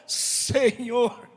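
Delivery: drawn-out call while sinking; mild cry for help. A narrator's reading. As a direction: call out with a touch of panic.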